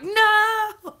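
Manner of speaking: sharp high shouted refusal; chat yelling no. Retold or imitated. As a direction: yell it like a whole crowd objecting. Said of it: "And everybody's like".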